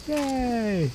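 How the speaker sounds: gleeful little "yay" up high; high-pitched cheer. Real, not acted. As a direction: cheer in a high, thin squeal. Got the ammo.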